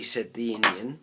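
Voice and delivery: ordinary delivery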